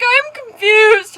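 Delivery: high, slow, loud puzzlement; mock confusion. Storyteller reads out her confused text, exaggerated confusion. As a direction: draw it out as hammed-up bafflement. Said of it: "I put a confused face".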